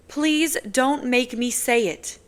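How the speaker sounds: woman begging to avoid a confession; pleading. Acted. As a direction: beg him not to push. Nazira pleading.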